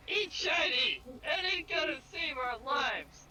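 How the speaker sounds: high squealing loud kobold plea. Acted. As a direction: shriek it out like a desperate little kobold. Kobold begging for its life.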